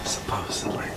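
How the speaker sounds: hushed whisper about the dead; soft unvoiced whisper. Real, not acted. Whispering about dead woman's room.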